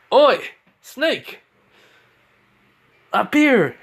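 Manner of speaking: high taunting call from above. Acted. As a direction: holler up high to get their attention. Naruto calls out to Orochimaru.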